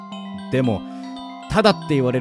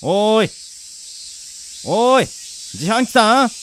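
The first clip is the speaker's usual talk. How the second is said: raised, questioning call; 6 semitones higher, much slower than usual. Acted. Calls to unresponsive vending machine. (自販機に呼びかける声)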